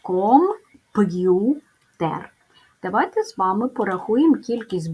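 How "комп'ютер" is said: Комп'ютер drawn out syllable by syllable, rising; slow syllable demo. Shown as a demonstration. Teacher shows the hard sound before the apostrophe, slowly. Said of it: "попередній приголосний буде твердим".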